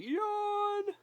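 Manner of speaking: high, loud mock yawn; imitated yawn. Owner mimicking his yawning puppy, loud high mock yawn. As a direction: voice the dog's big yawn, high and drawn out. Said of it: "He's yawning".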